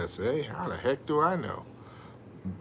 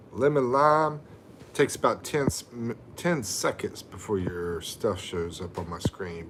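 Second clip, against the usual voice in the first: normal talking voice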